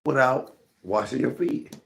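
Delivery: high-pitched incredulous question; retold bafflement. Questioning a friend about unwashed feet, retold bafflement. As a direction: ask it in high, mock disbelief. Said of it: "I didn't understand that".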